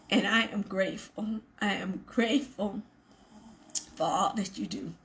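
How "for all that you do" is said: ordinary talk, nothing marked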